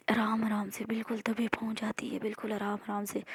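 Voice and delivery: hushed whisper, tiptoeing. A narrator's reading. Narrator whispering as she creeps up on the thief. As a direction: breathe it out as if on tiptoe.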